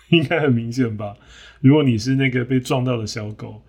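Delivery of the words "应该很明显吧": usual speaking voice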